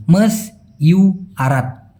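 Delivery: মস ইউ আরাত broken into slow separate syllables, mos-iu-rat; মস ইউ আরাত spoken syllable by syllable. Shown as a demonstration. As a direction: break মস ইউ আরাত apart slowly for the learner. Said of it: "দেখুন আমি ভাঙায় দিছি".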